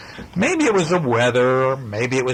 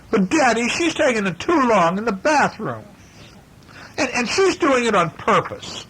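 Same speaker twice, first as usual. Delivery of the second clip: much higher, whining complaint; whiny little bear; 11 semitones higher than usual. Acted. Brother whining to Papa about his sister. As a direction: tattle to Dad in a whine.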